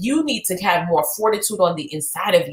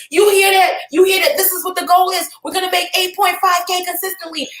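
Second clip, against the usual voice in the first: retold shouting; 8 semitones higher than usual. Talking to imagined employees, rallying shout. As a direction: shout it to a team that isn't there yet. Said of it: "And I'm screaming".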